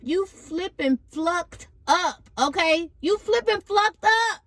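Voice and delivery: high sassy scolding; playful high-pitched telling-off; sassy censored scold; mock scolding.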